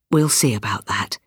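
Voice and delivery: low, gentle father's reply. A narrator's reading. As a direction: soothe her in a low voice. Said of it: "but he said gently".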